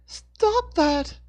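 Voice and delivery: high, loud scolding mother; scolding mom. Acted. Hannah scolds the cultist hitting Dixon, like a scolding mom. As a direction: scold him like a mom would. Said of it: "sounding more like a scolding mom".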